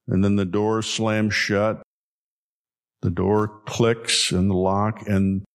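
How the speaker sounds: usual speaking voice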